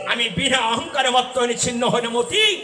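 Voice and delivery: high, loud dramatic storytelling; preaching at full force. Acted. Preacher voicing the uncle and the child, high and loud. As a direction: cry out the story's dialogue to the crowd. Shouted exchange between story characters.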